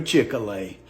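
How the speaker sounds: "Chick-fil-A" slurred the Southern way; Southern pronunciation. Shown as a demonstration. Giving proper pronunciations in the South, "Chick-fil-A" slurred Southern-style. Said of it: "a few proper pronunciations in the South".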